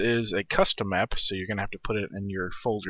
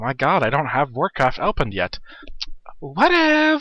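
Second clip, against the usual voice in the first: very high squeaky mock alarm; hammed-up high-pitched alarm; mock panic; 5 semitones higher, 5 dB louder, slower than usual. Joking panic, Warcraft not opened.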